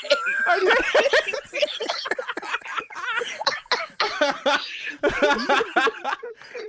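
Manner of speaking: high wheezing fit of laughter; hysterical laugh. Real, not acted. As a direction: lose it completely, cackling. Host bursts out laughing.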